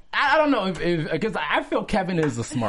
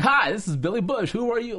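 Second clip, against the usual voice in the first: higher-pitched TV host greeting; Billy Bush; 9 semitones higher than usual. Retold or imitated. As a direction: answer the phone as if hosting a show. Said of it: "sounded like he was hosting a show".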